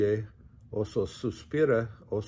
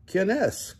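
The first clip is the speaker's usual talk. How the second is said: mouse shouting; 9 semitones higher than usual. A narrator's reading. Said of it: "grita el ratón".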